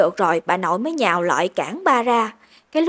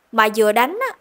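usual speaking voice

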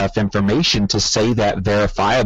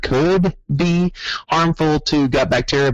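"could" repeated with a raised pitch; stress on could; 6 semitones higher than usual. Pretend. Correcting overbold diet claims, stress on "could". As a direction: lift the voice on "could" to underline it. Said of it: "that's the word".

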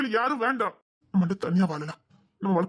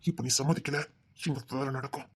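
low menacing threat; 7 semitones lower, 6 dB quieter than usual. Acted. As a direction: drop the voice low and soft. Man threatens girl. (தாழ்ந்த குரலில் மிரட்டல்)

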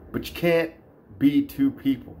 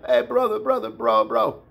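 higher-pitched Caucasus fighter greeting; imitated Caucasus accent; 5 semitones higher than usual. Mocking how Caucasus guys greet each other, imitated accent. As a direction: rattle off brothers in a mock Caucasus accent. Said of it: "why do they say brother all the time".

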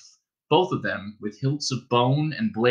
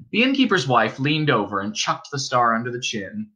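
ordinary delivery

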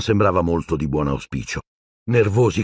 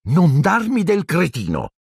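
put-on high-pitched boyish comeback; indignant retort; 11 semitones higher than usual. A narrator's reading. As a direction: shoot back high, offended. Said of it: "ribatté Neville". (ribatte indignato, voce acuta)

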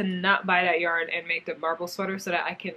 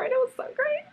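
much higher, squeaky so great; squealing excited; faster than usual. Real, not acted. Vlogger recalling the yarn store, high squeal. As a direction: squeal with delight.

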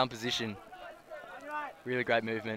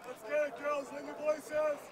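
light mock cheer, higher but soft; imitating a sideline call; 9 semitones higher, 11 dB quieter than usual. Commentator mimics Cron rallying the Terra girls, mock cheer. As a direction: echo a teammate's rally call playfully. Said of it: "Cron calling the Terra girls into action".

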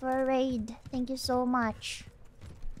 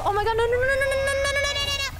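high rattle of nos; squeal, overwhelmed by the crowd; flustered panic; 11 semitones higher, 3 dB louder, much faster than usual. Real, not acted. Overwhelmed by many people.

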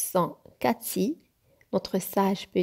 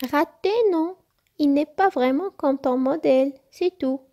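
little fox; 7 semitones higher, 5 dB louder than usual. A narrator's reading. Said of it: "reprit Cathy".

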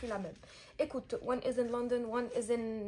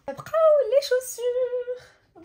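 excited announcement; 12 semitones higher, 13 dB louder, much slower than usual. Real, not acted. Announces the shoes.

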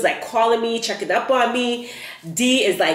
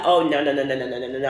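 rapid-fire refusal; 6 semitones lower, much faster than usual. Retold or imitated. Refusing to give up passport.

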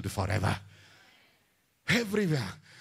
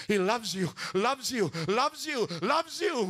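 each loves you pitched higher, from speaking level to high; building repetition; 7 semitones higher than usual. Real, not acted. Preacher assuring his listeners of God's love, rising higher. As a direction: climb with every repeat.

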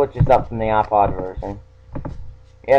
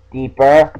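coaxing, then pressing louder; louder insistent deeper; 3 semitones higher, 7 dB louder than usual. Shown as a demonstration. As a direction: push it harder and louder. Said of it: "Look deep inside you".

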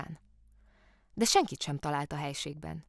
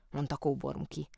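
ordinary delivery

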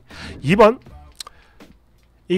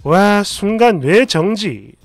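ordinary delivery

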